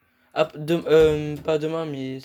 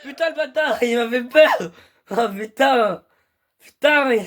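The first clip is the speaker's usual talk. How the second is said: shaken after a jump scare; high, rattled cursing; scared horror game streamer; 6 semitones higher, 3 dB louder than usual. Real, not acted. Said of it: "d'avoir crié comme ça".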